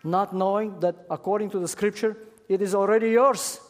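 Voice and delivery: loud, high preaching build; full-voiced pulpit preaching; preaching at full force. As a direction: proclaim it to the congregation with fire. Preacher rising to emphasis.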